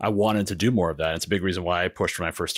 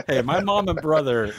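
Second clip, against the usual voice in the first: joking; 10 semitones higher, 4 dB louder than usual. Real, not acted. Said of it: "No, I'm totally kidding".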